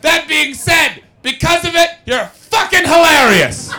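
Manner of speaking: loud, slow, shouted verdict from the crowd; heckling Scottish woman. Retold or imitated. Comic mocking a heckler, slow loud shout. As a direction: bellow it like a heckler standing up mid-show. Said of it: "a woman stood up and went".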